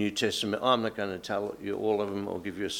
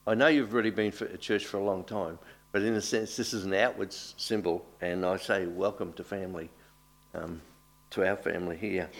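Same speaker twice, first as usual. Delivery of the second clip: ordinary talk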